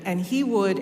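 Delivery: usual speaking voice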